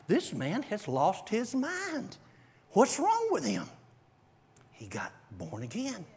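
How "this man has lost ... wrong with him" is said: stunned disbelief. Retold or imitated. Stunned inner thought.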